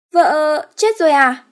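high, slow, loud puzzled question; high childlike dismay; bewildered simpleton. Acted. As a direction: sound dumbly confused. Ất asks bewildered.

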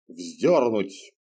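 lightly voiced stern command; curt order. A narrator's reading. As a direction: snap out a death order. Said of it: "распорядился гонец".

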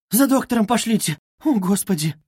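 high, distressed plea; frantic. A narrator's reading. Sonya appealing to everyone around, frantic. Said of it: "металась она ко всем".

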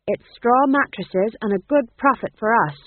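firm rebuttal. Acted. Boss refusing companion feather beds, firm.

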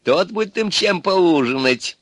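high, stretched-out gleeful cries; delighted. A narrator's reading. Baba Yaga spots the groom coming, gleeful. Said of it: "обрадовалась Баба Яга".

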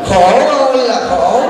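slow mock-wailing "khổ ơi là khổ", drawn out on "ơi"; sarcastic lament. Monk teasing the wife about her easy life, slow mock lament. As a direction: drag out "Khổ ơi là khổ" like a fake moan. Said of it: "sarcastic refrain repeated".

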